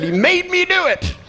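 very high, strained mock cry; hammed-up squeal of self-defence; panicked blame. Comic shoves psychiatrist at the raging patient, high panicked blame.

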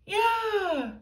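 high, loud "yeah"; agreeing cry. A narrator's reading. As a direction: back up your friend loudly. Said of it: "cried Harold".

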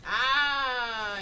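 loud drawn-out cry; dismayed wail. Real, not acted. Gamer after a botched move, loud dismayed wail. Messed up in game.

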